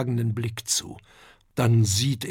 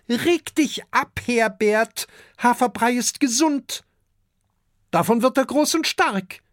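much higher, brisk calming mother; soothing dragon mother; 10 semitones higher, 3 dB louder than usual. A narrator's reading. Dragon mother calms Herbert about the porridge, high-voiced. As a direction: brush off his grumbling from up high. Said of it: "sagt Adele".

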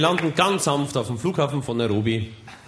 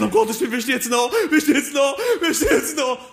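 rising panic; 10 semitones higher than usual. Acted. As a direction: voice climbing higher in panic. Panischer Absturz, Stimme steigt. (immer höher, panisch)

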